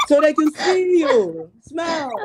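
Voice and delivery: slow, high-pitched imitation of coworker; Indonesian coworker. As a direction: mimic her loudly, drawing it out. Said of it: "she came to me, said".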